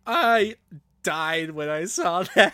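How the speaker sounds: loud, high, drawn-out words breaking into laughter; real laughter. Real, not acted. Co-host recalls the coin tornado scene, laughing hard. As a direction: crack up while saying it.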